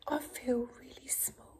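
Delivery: snail's small whisper; whispered confession. Acted. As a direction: say it in a tiny whisper. Snail speaks in story.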